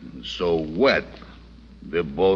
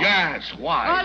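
sharp demand; 11 semitones higher than usual. Acted. Gang pressures man to help.

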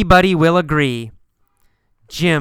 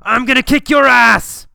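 high, loud, acted angry shout; storybook fight threat, shouted; angry yell; 8 semitones higher, 5 dB louder than usual. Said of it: "He yells and says".

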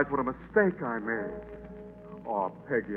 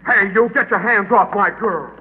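angry; 8 semitones higher, 5 dB louder than usual. Acted. Boyfriend threatens angel.

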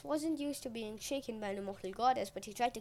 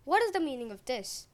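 louder, indignant question; stern demand; 3 semitones higher, 6 dB louder than usual. A narrator's reading. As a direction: demand an explanation from the guests. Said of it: "he asked their guests".

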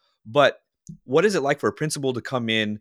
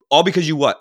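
loud and higher quiz; coach quizzing; 6 semitones higher, 8 dB louder than usual. Retold or imitated. Coach checking his hitter's learning, loud and higher. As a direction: demand the answer from the kid. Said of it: "he's checking for learning".

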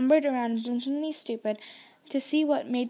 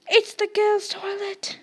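high, slow, horrified gasp; gasped realisation in alarm; 9 semitones higher, 4 dB louder than usual. A narrator's reading. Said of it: "Harry gasped".

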